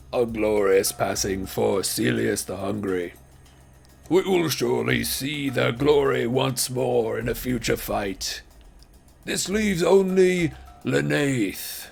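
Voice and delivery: booming arena announcer voice; fighting game announcer. Acted. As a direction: announce it like a grand arena herald. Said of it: "the arena announcer once again pipes up".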